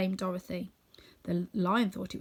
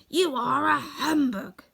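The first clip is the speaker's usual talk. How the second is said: slow, raised pronouncement; grave tone; 6 semitones higher, 5 dB louder, slower than usual. A narrator's reading. Scarecrow accuses the wizard, slow and grave. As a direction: pronounce it gravely, drawing out each word. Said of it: "grave tone".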